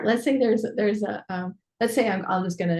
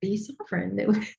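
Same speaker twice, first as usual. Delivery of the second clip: usual speaking voice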